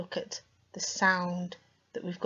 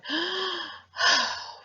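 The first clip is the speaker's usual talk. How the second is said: weary sigh; 11 semitones higher than usual. Retold or imitated. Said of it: "What shall I do next?".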